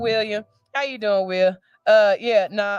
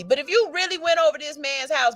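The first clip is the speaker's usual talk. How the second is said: ordinary talk, nothing marked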